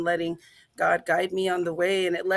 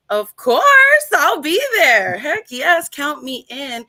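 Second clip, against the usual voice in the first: retold eager yes, much higher and louder; excited yes; 8 semitones higher, 9 dB louder, slower than usual. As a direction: answer the invitation with a thrilled yes. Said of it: "And I was just like".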